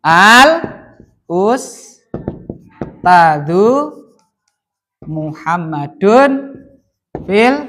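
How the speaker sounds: loud, piece by piece with long pauses; slow dictation. Real, not acted. Teacher spells out the example for the class, slow dictation. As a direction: dictate it bit by bit, stretching each piece. Dictating the sentence while writing it.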